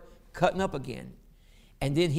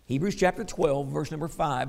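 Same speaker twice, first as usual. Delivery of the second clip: usual speaking voice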